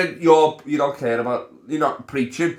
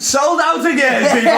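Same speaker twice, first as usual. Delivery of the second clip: slow, high, loud showman call; mock announcer; 11 semitones higher, 5 dB louder than usual. Joking to his friend about a sold-out gig, mock announcer. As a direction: hype the crowd like a ringmaster.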